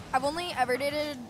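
usual speaking voice

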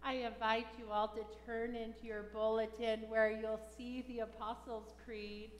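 ordinary delivery

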